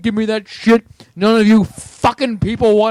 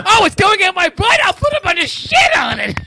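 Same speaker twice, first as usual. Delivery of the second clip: weird random voice; 10 semitones higher than usual. Acted. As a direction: yell it in a silly high character voice. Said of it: "I was just doing a weird random voice".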